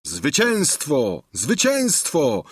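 slow, drawn-out triumphant cries; okrzyki zwycięstwa. A narrator's reading. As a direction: cry victory, stretched out. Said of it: "wzniosły się okrzyki". (okrzyk tryumfu, przeciągły)

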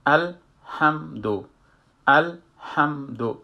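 slow teaching recitation of الحمدو; sukun on lam. Shown as a demonstration. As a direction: close the lam in الحمدو without a vowel.

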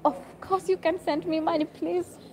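high, squeaky mock plea; mock begging. Vlogger jokingly begging viewers to send money, high mock whine. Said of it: "begging viewers for money".

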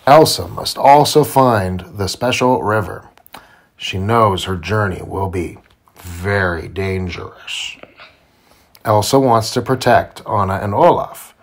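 usual speaking voice

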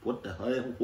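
plain reading voice

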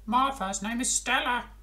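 high, odd starfish voice; a strange little starfish. Acted. Starfish tells frog its name, high.

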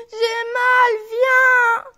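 high, loud tearful call; hurt child shouting through tears; crying for help. Acted. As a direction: wail for someone to come.

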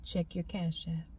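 hushed, low soft voice; God's whisper. Retold or imitated. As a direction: murmur it like a secret in the ear. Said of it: "he whispers in my ear".

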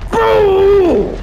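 sudden loud yell; shouting. Real, not acted. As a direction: yell it out in shock. Shouting at teammate in fight.

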